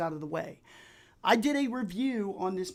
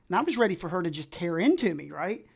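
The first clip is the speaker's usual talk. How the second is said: ordinary talk, nothing marked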